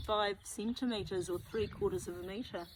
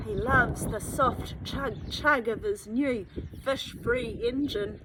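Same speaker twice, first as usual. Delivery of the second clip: ordinary delivery, nothing marked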